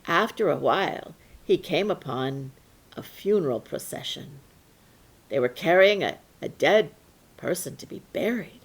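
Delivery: usual speaking voice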